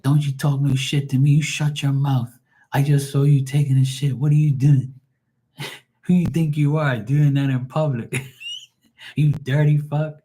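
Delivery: mountain lion voice. Retold or imitated. Retelling the best part, the lion scolding the commentator. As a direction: tell him off like an outraged lion. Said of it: "he's like".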